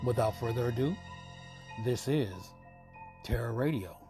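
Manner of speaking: ordinary talk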